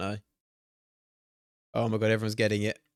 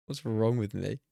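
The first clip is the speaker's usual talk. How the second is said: ordinary talk, nothing marked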